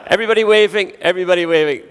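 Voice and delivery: high, loud call to the whole room; rally everyone to wave for the photo; calling out to crowd. Real, not acted. Getting the audience to wave.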